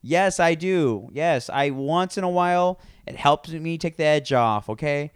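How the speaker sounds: worked-up mock confession; exasperated admission. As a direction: imitate a mother giving in under pressure. Retold mother's exasperated confession.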